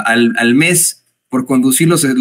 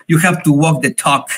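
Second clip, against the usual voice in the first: imitating her English. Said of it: "dando a conocer frases como".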